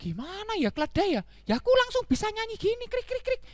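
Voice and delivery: ponder, then explain in high cricket voice; lightly voiced confused cricket; puzzled cricket. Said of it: "jangkriknya juga bingung".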